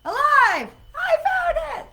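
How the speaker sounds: high, loud cry of triumph with a laugh; shrieking with real delight; excited shout. Real, not acted. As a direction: yell it out, thrilled to have found it. Finally finds the live button.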